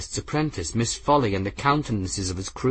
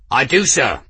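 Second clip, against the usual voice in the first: crisp reply; 4 semitones higher, 5 dB louder than usual. Acted. Engineer answering at attention.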